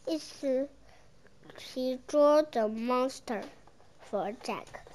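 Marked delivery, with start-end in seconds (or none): none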